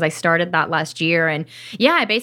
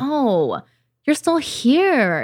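slow, drawn-out sympathetic greeting, pitched up; accepting, not angry; 6 semitones higher, much slower than usual. Retold or imitated. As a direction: coo it slowly to an old visitor. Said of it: "I'm not angry with myself the way I used to be".

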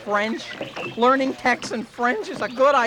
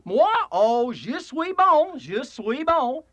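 Texan French; 2 semitones higher, much slower than usual. Acted. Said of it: "speak French like a Texan".

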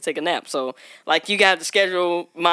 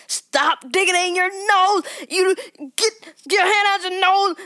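high, slow scolding mom voice; shrill-free high mock scold, drawn out; frazzled mom; 12 semitones higher, slower than usual. Mom scolding her toddler in Target, high mock scold. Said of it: "She was like".

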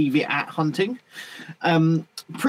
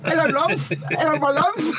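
high mock Southern English accent; a teasing, menacing sweet Southern English greeting; 10 semitones higher than usual.

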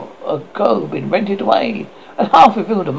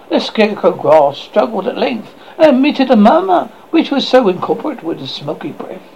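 ordinary delivery

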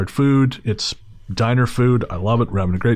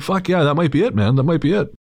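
higher, hearty retold bluff; feigned agreement; 6 semitones higher than usual. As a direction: play along, agreeing readily.

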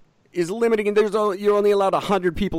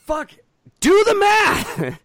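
exasperated outburst; 6 dB louder, much slower than usual. Real, not acted. Ranting to his cohost about store limits, exasperated high slow outburst. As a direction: do the math, slow, much higher and louder.

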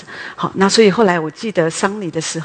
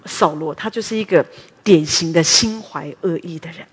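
ordinary talk

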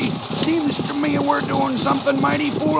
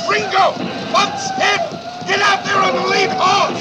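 shouted alarm to Ringo; urgent shout; 6 semitones higher than usual. Acted. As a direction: call out over the chase. Driver wounded in chase.

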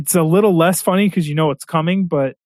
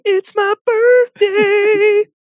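much higher, very slow, drawn-out Goldblum voice; Jeff Goldblum impression; much slower than usual. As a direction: sing out "birthday" high and slow like Goldblum. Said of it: "I'm just yelling".

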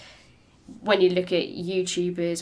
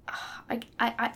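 ordinary talk, nothing marked

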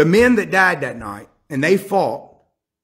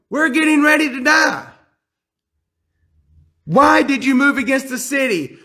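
desperate soldiers; 9 semitones higher than usual. Acted. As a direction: cry it out like men about to die. Soldiers voiced in their minds.

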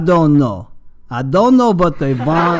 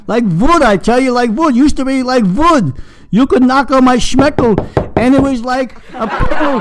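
higher, louder, rising indignation; getting higher and louder; worked-up complaint; 7 semitones higher, 5 dB louder than usual. Acted.

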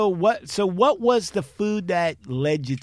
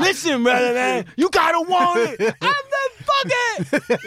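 shouting trainer; 12 semitones higher than usual. Retold or imitated. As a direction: bark encouragement like a drill coach.

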